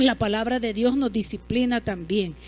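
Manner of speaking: usual speaking voice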